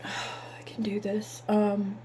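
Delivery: slow, breathy whisper holding back tears; steady yourself in a whisper before crying; whispering to herself to keep from crying; real whisper. Real, not acted. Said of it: "I'm gonna do this without crying".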